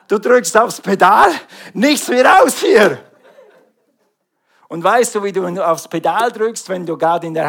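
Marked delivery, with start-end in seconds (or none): 0.1-3.0 loud, laughing burst of urgency; excited shout. Pretend. Preacher acting out racing from the tunnel, loud and laughing. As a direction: floor it and get out. Flooring the pedal to escape.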